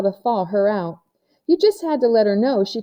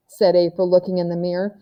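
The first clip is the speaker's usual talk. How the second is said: usual speaking voice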